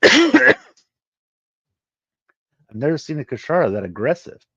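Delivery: a high, loud startled cry opening the line. Acted. Alarmed speaker after seeing the aggressive creature, high startled burst. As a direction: jolt up on I've then settle.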